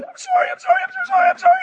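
very high squealed sobbing apology; mock hysterical crying. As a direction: imitate a wife wailing uncontrollably, words repeating. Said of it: "crying out of control".

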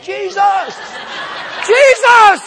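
a call that builds from high to a loud, very high yell; building cry. Retold or imitated. Crying out, lost in huge bed.

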